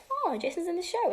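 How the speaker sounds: light delighted surprise; retold surprise. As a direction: recall spotting a friend on screen. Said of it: "So I was like".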